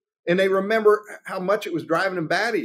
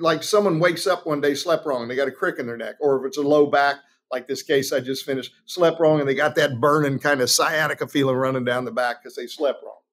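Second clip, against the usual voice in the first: usual speaking voice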